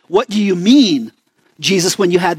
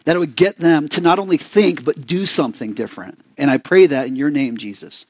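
prayer; 6 semitones lower than usual. Acted. Closing prayer before study.

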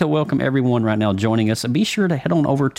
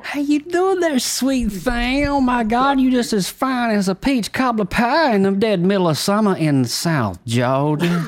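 high, hammed-up Southern Georgia accent; Southern drawl; 11 semitones higher than usual. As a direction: sweet-talk like a Southern charmer, in a Southern accent. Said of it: "I like that accent".

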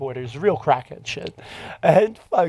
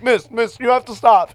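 loud, raised-pitch urgent intervention; retold cop's order; 8 semitones higher, 7 dB louder than usual. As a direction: imitate a cop calling a halt.

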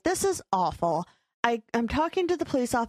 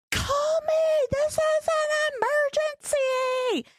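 frantic high shout, slow on emergency; screaming; much slower than usual. Retold or imitated. Woman retelling yelling at home cameras, high frantic shout. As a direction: yell at the cameras in a panic. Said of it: "I'm, like, screaming at them".

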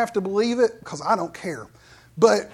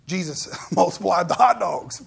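laughing in awe; 2 semitones lower than usual. Real, not acted. Telling wife and friend of miracle.

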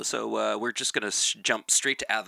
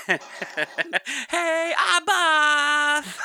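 very high, loud comic holler; Lou Costello; 8 dB louder, much slower than usual. Retold or imitated. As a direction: yell it out high and long, parodying a catchphrase. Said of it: "Lou Costello yell".

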